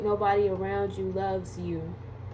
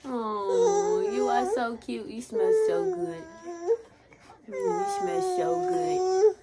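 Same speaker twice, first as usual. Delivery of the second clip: put-on much higher, cooing baby talk; 11 semitones higher than usual. Mother cooing to her baby, high baby talk. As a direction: coo to the baby like she's precious.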